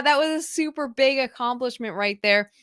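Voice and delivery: normal talking voice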